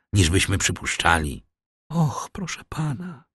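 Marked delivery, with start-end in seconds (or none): 1.9-3.2 high, soft mock cry; exclaiming. A narrator's reading. Mercedes protesting to the shipowner, softly crying out. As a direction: plead in a thin, raised voice. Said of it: "zakrzyknęła Mercedes".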